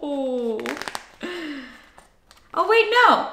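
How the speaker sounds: plain reading voice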